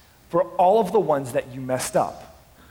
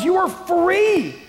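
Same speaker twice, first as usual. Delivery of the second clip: much higher and louder, pleading cry; shouting from the rooftops; 13 semitones higher, 4 dB louder than usual. Pretend. Grieving parent shouting at freed country, desperate loud plea. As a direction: shout it with all your lungs to the freed people. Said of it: "You would be shouting from the top of your lungs".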